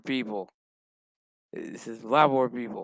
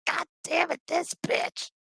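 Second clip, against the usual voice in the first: high, squeezed mock fury; comic high-voiced exasperation; mock pissed-off queen; 4 dB quieter than usual. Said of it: "the Borg Queen's pissed".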